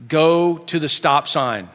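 slow, deliberate child's instruction, acted; measured driving command; giving directions. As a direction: spell out the route slowly. Boy gives directions, dad echoes.

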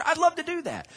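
ordinary delivery

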